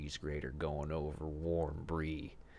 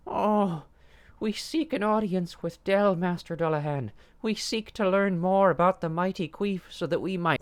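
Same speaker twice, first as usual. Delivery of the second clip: very high, loud groveling plea; high pleading servant; 12 dB louder than usual. A narrator's reading. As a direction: grovel before the demon. Barnaby pleading to Dullahan.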